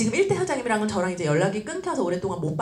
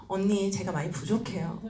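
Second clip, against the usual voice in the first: low, soft self-deprecation; humble apology; 4 semitones lower, 6 dB quieter than usual. Retold or imitated. Upline placating a difficult partner, soft humble tone. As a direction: give in meekly and softly. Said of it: "계속 겸손한 모습으로".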